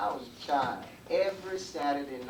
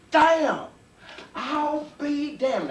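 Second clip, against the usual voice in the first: higher, louder oath, then dragged slow; devil cursing; 14 semitones higher, 9 dB louder, much slower than usual. Acted. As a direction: spit the curse out, then drag it. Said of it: "the devil said".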